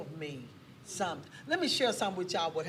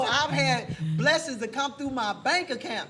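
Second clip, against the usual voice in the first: loud, raised-pitch testifying; impassioned preaching; 5 semitones higher, 7 dB louder than usual. Real, not acted. Promising the church God's provision, loud impassioned preaching. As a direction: preach it out over the congregation's responses.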